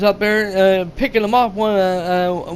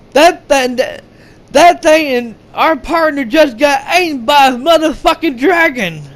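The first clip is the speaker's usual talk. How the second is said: high, loud stammering panic; panicked outburst; 5 semitones higher, 4 dB louder than usual. Retold or imitated. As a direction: spit it out in shock and fear. Panicked soldier after partner eaten.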